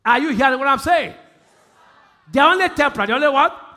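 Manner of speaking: loud, high preaching call to the crowd; preaching shout. Real, not acted. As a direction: shout it out to the church.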